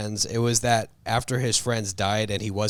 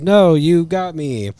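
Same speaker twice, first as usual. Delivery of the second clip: higher, louder, slow mock dismay; Cassandra defeated; 6 semitones higher, 5 dB louder, slower than usual. Villain Cassandra caught by the helmet trick, slow mock dismay. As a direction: wail that you've been caught.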